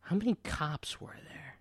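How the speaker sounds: unvoiced whisper. Real, not acted. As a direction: whisper the question. Whispered question.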